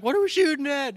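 high-pitched confused cry; mock alarm. Buddies tripping around during the shooting, alarmed. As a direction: call it out in bewildered panic.

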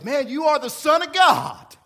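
Satan cheering Jesus on, loud and high; excited Satan. Acted. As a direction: hype Jesus up with a whoop. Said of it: "he goes".

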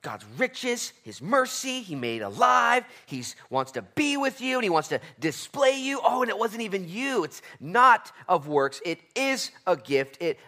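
high, loud, slow words of grace; passionate preaching. Acted. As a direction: pour it out at full force. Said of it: "He says".

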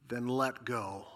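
God calmly says the hard thing; soft God command. Acted. God's final command.

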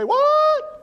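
high, loud call of What; shouted What. Shown as a demonstration.

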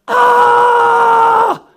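sudden high mock roar of a parent losing it; frustrated parent yell. Parent hitting boiling point, high loud mock scream.

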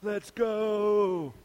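a mock early riser, giddy at four a.m. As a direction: play the hyped early riser. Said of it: "excited at four A M".

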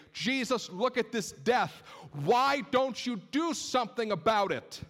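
high, insistent, pleading outcry; frustrated demand. Acted. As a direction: challenge Jesus, voice pushed up high. Crowd acted out, demanding Jesus act.

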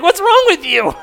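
loud high exasperated wife voice, hammed up; high mock Lydia. Wife mocking his emotions, high loud exasperated squeal. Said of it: "Lydia's like".

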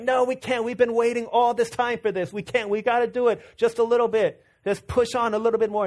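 put-on high-pitched child begging to keep going; pleading little kid. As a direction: urge them on like an insistent four-year-old. Acted child pleading to stay up.

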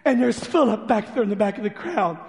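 ordinary talk, nothing marked

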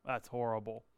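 low, wrinkled-nose disgust; disgusted reaction. Retold or imitated. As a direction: recoil from a sour smell. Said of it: "and you go".

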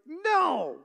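mock defiant toddler shout. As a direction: imitate a two-year-old's loud, high refusal. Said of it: "Lucas is now a little defiant".

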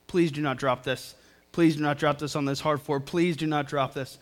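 low, soft retold pleading; tense quiet plea. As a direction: beg softly, as if to yourself. Silently begging a child holding glass.

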